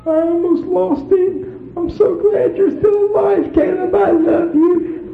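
slow, high weepy imitation; mock crying. Wife weeping over her baby for days, high mock crying. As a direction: weep it out in a high voice. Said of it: "she was crying day after day".

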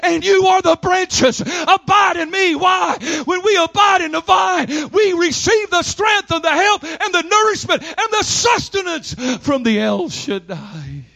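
calling out to the church about abiding in the vine; full-force preaching. Acted. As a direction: build to a shout and hold it.

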